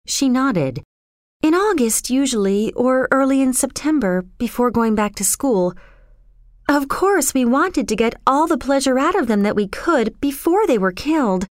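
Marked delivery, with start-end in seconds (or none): 1.4-11.5 put-on higher-pitched girl, calmly explaining; airy, untroubled girl character; matter-of-fact young girl. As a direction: explain horrors lightly in a high girl's voice.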